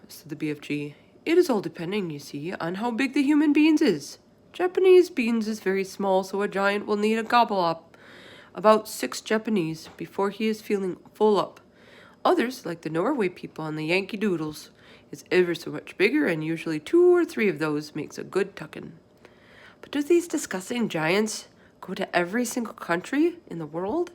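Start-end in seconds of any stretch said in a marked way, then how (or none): 1.3-18.9 BFG voice. Acted. Giant explaining to Sophie what the others do, folksy. 19.8-24.1 lightly voiced girl, higher, worried; a little girl asking in alarm; Sophie voice. Sophie asking the giant in a worried girl's voice.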